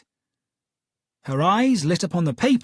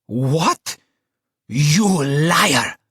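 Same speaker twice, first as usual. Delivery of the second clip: put-on louder furious roar in an Italian accent; 6 dB louder, much slower than usual. Client to Holmes, roaring at his lies. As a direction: roar it like a wounded tiger. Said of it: "she roared".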